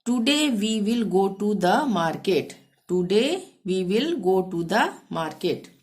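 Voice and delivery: each English word said very slowly and clearly; slow dictation. Shown as a demonstration. Teacher dictating the English sentence, very slow. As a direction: spell it out slowly for learners. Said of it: "English translation for learners".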